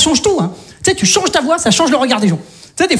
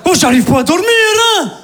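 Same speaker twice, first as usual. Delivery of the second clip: frantic deranged shout; crazed neighbor rant; 9 semitones higher than usual. Acted. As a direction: play a sleepless madman banging on the door. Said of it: "je fais".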